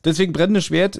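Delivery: usual speaking voice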